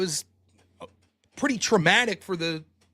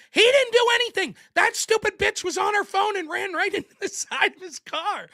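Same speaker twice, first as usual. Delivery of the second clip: yelling; 12 semitones higher than usual. Retold or imitated. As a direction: yell it like a furious witness taking his side. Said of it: "like, yelling".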